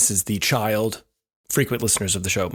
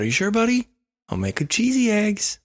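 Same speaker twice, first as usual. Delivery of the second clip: much higher, sing-song coaxing; coaxing dad; 11 semitones higher than usual. Retold or imitated. Dad coaxing his sick son with cheesy eggs, high and sweet. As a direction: sweeten it up to tempt a sick kid.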